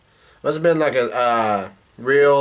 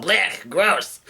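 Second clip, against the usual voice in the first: mock disgusted yell; 5 semitones higher than usual. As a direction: imitate someone spitting out bad food. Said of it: "Luffy yells out".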